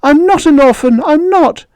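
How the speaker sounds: very high, loud tearful protest; a boy denying it through tears; crying protest. Acted.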